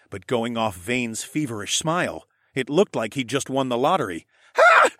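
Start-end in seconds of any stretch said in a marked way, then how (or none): 4.6-4.9 very high, loud villain elation; triumphant cry. A narrator's reading. Villain rushes into the waters, crying out triumphantly. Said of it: "Vane cried".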